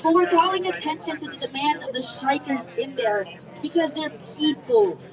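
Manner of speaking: ordinary delivery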